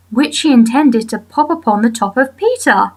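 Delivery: plain reading voice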